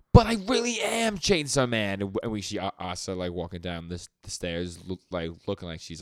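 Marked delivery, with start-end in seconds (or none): 0.1-2.0 much higher, louder despairing plea; a boy no one will believe; Denji, anguished. Retold or imitated. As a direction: cry it out desperately, high and loud. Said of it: "and he's like".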